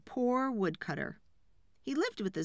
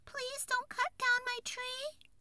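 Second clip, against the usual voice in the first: very high, slow fairy plea; begging tree fairy; 3 dB quieter, slower than usual. A narrator's reading. Tearful fairy begs the frightened woodcutter, high and pleading. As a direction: beg slowly in a tiny high voice. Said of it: "begged the fairy".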